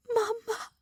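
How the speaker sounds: choked, high, tearful cry of a name; tearful whisper. Acted. Girl reaching her mother after years, crying softly. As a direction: break on the word through tears. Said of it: "I whisper, tears falling down".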